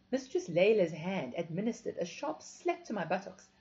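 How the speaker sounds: usual speaking voice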